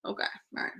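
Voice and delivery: breathy whispered okay, under the breath; a hushed aside. Retold or imitated. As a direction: murmur it to yourself, barely voiced. Medium's whispered reply to spirit.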